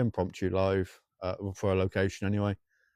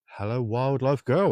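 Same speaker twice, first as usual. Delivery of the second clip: drawn-out greeting; 2 semitones higher, 4 dB louder, slower than usual. Acted. Greeting viewer name, drawn out.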